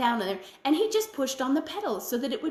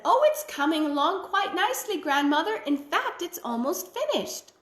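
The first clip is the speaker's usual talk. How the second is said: cat's voice; 6 semitones higher than usual. A narrator's reading. Cat answers the witch again.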